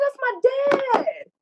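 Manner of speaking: very high imitated wail; high, upset re-enactment; retold tearful protest. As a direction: act out protesting while crying. Re-enacts her tearful protest to him.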